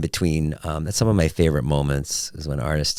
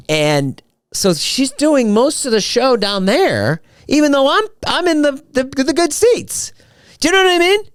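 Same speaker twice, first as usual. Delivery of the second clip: excited; 4 dB louder than usual. Real, not acted. Excited high-pitched rant about seats.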